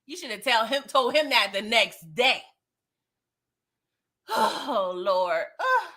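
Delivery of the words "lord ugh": ordinary delivery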